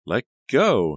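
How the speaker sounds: loud, high cry while pulling free; struggling shout. Acted. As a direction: yell while fighting his grip. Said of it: "she struggled to pull free".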